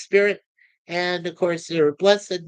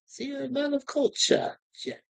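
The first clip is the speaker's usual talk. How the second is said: higher, softer put-on English accent; rough English accent; 7 semitones higher, 5 dB quieter than usual. Host playfully quotes a chat compliment to Jeff, rough English accent. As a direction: have a go at an imperfect English accent. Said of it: "Not my best English accent".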